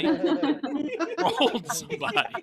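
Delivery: slow laughing punchline. Retold or imitated. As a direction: crack up saying it.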